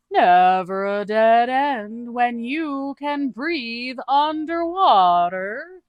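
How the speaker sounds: high, slow, drawn-out quirky voice; odd character voice. Acted. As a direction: stretch each word out in a high, cheerful oddball voice. Doog's voice, unnamed.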